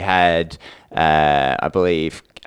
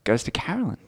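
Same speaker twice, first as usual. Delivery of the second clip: suspenseful whispered announcement; whispering; 6 semitones higher, 9 dB quieter, faster than usual. Acted. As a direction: whisper the winner's name. Announcing the scarf winner.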